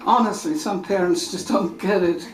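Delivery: normal talking voice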